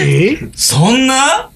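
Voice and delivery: high-pitched mock disbelief; retold surprise, pitch leaping up; taken aback. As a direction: react with a rising, incredulous question. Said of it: "みたいな。".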